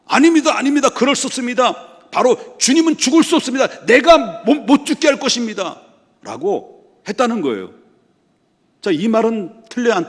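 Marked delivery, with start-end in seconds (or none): 0.0-5.8 loud, high-pitched frantic pleading; impassioned protest. Acted. Peter grabs Jesus to stop him dying, loud desperate protest. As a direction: plead urgently, voice raised high, as if shaking him. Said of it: "항변했다는 건 뭡니까? 예수님 붙들고 막 흔들었다".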